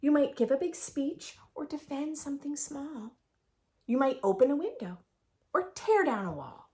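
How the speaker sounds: lively sing-song picture book read; reading to children. A narrator's reading. Teacher reads the rhyming book to her class. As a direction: read the rhymes aloud with bounce.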